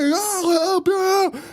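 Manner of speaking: high, wailing cry for help; hammed-up, very high-pitched plea; mock screaming. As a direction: imitate frantic screams through a door. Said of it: "still screaming at the top of his lungs".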